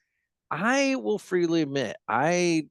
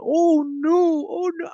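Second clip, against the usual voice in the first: high squeaky voice; 4 dB louder, much slower than usual. Retold or imitated. Captioning the monkey meme.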